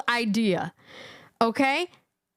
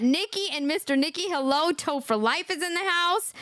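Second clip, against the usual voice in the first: normal talking voice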